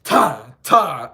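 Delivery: "ta" sung loud with a sharp tongued t; hard ta attack. Shown as a demonstration. Trumpeter contrasts the hard attack, a loud "ta". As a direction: clip "ta, ta" off with the tongue. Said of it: "instead of".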